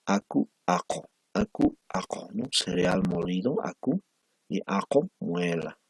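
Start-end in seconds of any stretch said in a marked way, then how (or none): 0.1-2.3 aku and ako set apart by the final vowel, u against o; minimal pair drill. Shown as a demonstration. Teacher drilling a minimal pair for students, clearly articulated. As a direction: model aku then ako for the class to repeat. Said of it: "vamos a estar repitiendo eso".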